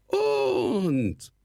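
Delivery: very high goblin voice full of scorn; a scornful goblin's squawk; sneering kobold rasp. A narrator's reading. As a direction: talk down to him like a mocking goblin. Kobold continues.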